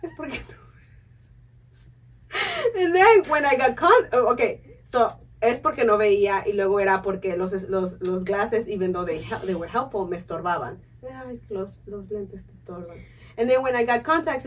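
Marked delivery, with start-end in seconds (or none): 0.0-1.9 mom's voice. Retold or imitated. Mom's saying repeated. 11.0-13.0 a lower, softer motherly tut; mom's voice. Retold or imitated. Imitating her mom's excuse about her falls, lower and soft.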